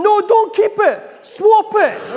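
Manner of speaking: very high, loud, slow yelling; dad at the back screaming high and loud at his son; frantic shout. Retold or imitated. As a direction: bellow it at the boy over the crowd. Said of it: "And I'm shouting".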